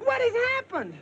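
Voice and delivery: very high, insistent question; urgent demand. Acted. Husband brushing aside wife's questions, urgent demand. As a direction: cut in and demand to know. Asks again, louder and more urgent.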